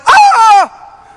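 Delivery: loud, high laughing cry of shock; sudden shocked yelp; startled shout. Retold or imitated. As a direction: gasp it out as a yell. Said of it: "And I went".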